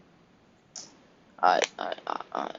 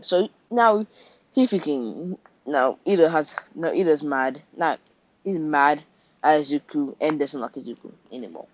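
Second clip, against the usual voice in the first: ordinary talk, nothing marked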